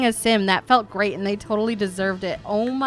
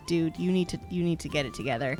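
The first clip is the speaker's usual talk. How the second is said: quick quiet scolding; 4 semitones lower, 10 dB quieter, much faster than usual. Real, not acted. Scolding sim again.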